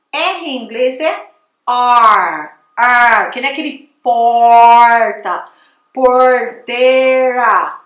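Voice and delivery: R and porteira dragged out with a long curled r; exaggerated American R. Shown as a demonstration. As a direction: overdo the retroflex r slowly. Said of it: "eu tô exagerando pra você ver". (R americano exagerado e arrastado)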